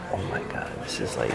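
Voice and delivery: awestruck near-whisper; retold breathless awe, very soft and low; hushed awe. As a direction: barely voice it, stunned by what's ahead. Retold hushed awe at huge room.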